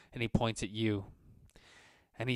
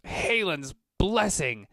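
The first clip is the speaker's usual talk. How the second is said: much higher, louder, slow gasp of alarm; startled oath; 8 semitones higher, 7 dB louder, slower than usual. Acted. Merchant sees the Minotaur and blurts out a loud, shocked oath.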